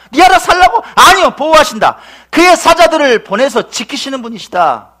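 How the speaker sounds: loud, high, fervent pulpit delivery; full-force preaching. Real, not acted. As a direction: proclaim it to the congregation.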